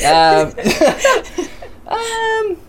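high-pitched giggling; laughing. Real, not acted. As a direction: giggle helplessly. Laughing fit.

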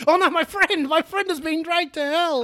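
high, wailing oh no; hammed-up despair. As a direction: wail it like a soap opera. Hammy grief over friend.

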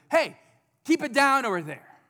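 mock-scold the noisy kids from across the house; parent shushing kids.

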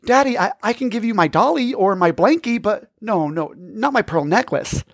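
put-on cling to the necklace, stammering like a shocked four-year-old; pleading little girl.

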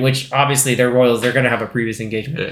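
usual speaking voice

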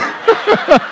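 laughs high at his own joke; high burst of laughter. Real, not acted. Preacher cracking up at his own punchline, high laugh. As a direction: let a high laugh escape.

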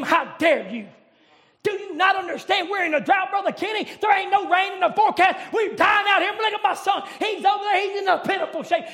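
mock outrage at the prophet; defiant widow protesting the drought; indignant widow. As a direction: scold him, half shouting. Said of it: "she didn't have that attitude".

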